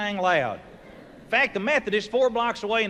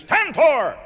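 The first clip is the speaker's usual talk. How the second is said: higher, louder call of Tantor; Tarzan calling out; 6 semitones higher, 5 dB louder than usual. Retold or imitated. Tarzan hails the elephant Tantor, loud call. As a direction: call the elephant across the jungle. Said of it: "Tarzan looks out and he says".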